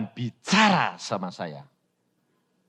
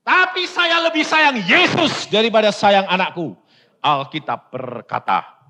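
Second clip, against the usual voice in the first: louder, higher, firm declaration; firm retort; 6 semitones higher, 6 dB louder than usual. Retold or imitated. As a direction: declare it firmly. Father's firm retort, retold. (jawaban tegas dan lantang)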